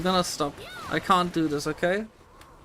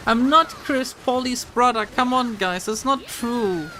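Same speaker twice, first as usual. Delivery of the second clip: protesting denial; 6 semitones higher, 4 dB louder than usual. Real, not acted. Denies being Chris Polly's brother.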